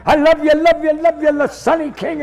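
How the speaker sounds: effusive stage impression. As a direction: impersonate an old-time entertainer, rapid repeated endearments. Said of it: "and I was a [name]".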